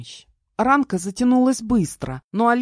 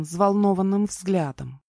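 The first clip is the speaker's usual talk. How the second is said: ordinary delivery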